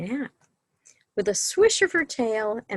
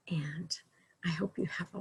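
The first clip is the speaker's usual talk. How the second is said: soft goodbye whisper; 3 semitones lower, 11 dB quieter, faster than usual. Real, not acted. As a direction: whisper the farewell gently.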